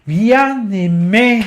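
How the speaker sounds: projected, lifted reading of the lyric. Shown as a demonstration. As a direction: declaim it with growing volume. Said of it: "at least in volume".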